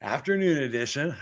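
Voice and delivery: usual speaking voice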